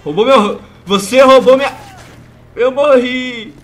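loud high protest; indignant yell. Real, not acted. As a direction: cry out at the stolen kill. Kill stolen, then died. (protesto indignado)

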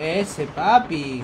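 stretched-out approving call; long, drawn-out hype call; drawn-out cheer. Real, not acted. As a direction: cheer it slowly, drawing out each word. Cheering a play in game.

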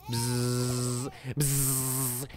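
buzzing zap sound effect; electric buzz noise. Pretend. Imitating electric shock.

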